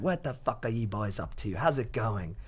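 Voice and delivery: imitating a British woman, pitched slightly down; British accent. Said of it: "five British girls walk in".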